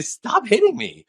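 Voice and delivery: high, mock-shrill stop hitting me; playful high-voiced wife impression; mock wife protest. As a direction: squeak it like an offended wife. Imitating wife protesting being hit.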